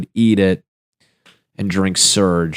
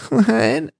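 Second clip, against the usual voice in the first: shocked disbelief. Retold or imitated. Told he's a girl by the doctor, shocked squeal. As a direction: react in total disbelief. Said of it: "And I'm like".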